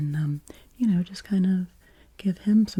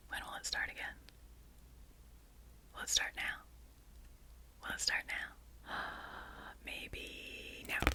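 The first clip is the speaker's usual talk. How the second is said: hushed, much softer whisper with gasps; anticipation whisper; 17 dB quieter than usual. Acted. ASMR host teasing viewers, waiting for the sound, in a soft whisper. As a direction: tease the waiting listener in a breathless hush.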